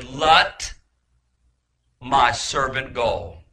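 slow, emphatic command with a long pause; lightly voiced divine decree, slow and weighty; God. God ordering hell to release his servant, lightly voiced decree. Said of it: "it was the voice of God".

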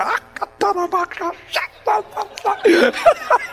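high-pitched burst of tongues syllables; playful re-enacted glossolalia; speaking in tongues. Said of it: "a couple little other tongues things".

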